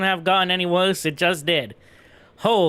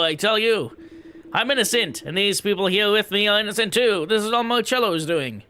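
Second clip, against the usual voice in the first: higher, pompous protest of innocence; indignant High Priest; 4 semitones higher than usual. Acted. High Priest demanding release from his cell, indignant. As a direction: demand to be let out as if outraged.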